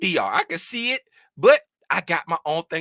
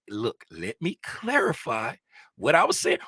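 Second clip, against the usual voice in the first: real calm voice; 6 semitones lower, 4 dB quieter than usual. Retold or imitated. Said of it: "when I come on with my real calm voice".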